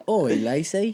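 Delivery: hammed-up Australian hail; badly faked Australian accent. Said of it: "let me sharpen up a little bit".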